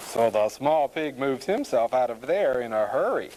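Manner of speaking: ordinary delivery, nothing marked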